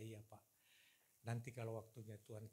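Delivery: low, soft, retold deference; retold placating reply. Avoiding offence to his pastor, softly placating. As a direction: play down a meek reply, low and quiet. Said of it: "Saya coba ketawa-ketawa aja".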